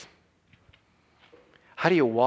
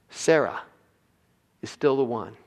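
ordinary delivery

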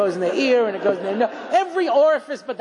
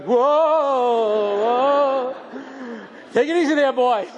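mock horse rider. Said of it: "and we're going".

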